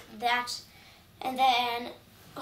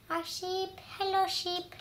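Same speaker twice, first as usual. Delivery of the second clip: cute voice for the sheep; 6 semitones higher than usual. Acted.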